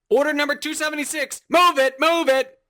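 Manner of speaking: high, loud, rushed shout; yelling manager. Acted. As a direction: bark urgent orders over a busy kitchen. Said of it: "Your manager is yelling".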